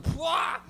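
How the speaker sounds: loud, high explosive "pwah"; imitated impact noise. Comic acts out being hit and thrown back, explosive noise. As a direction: blurt out the blow like a cartoon hit.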